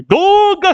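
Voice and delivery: high drawn-out whoop into cackling; Loki's big laugh. Retold or imitated. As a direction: imitate a giant character's booming laugh. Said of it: "his laugh, but we hear".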